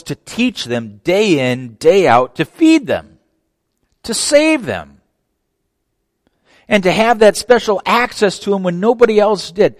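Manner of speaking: loud, rising pulpit climax; fervent preaching. Acted. Preacher asking how anyone could reject Jesus, climbing and loud. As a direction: build the question to a peak.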